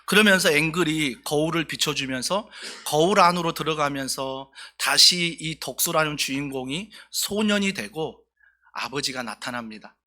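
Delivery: usual speaking voice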